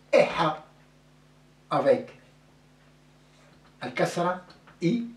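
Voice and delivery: ordinary delivery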